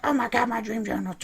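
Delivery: high, put-on shocked girl voice; mock gasp. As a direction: ham up Emma's horror. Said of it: "she's like".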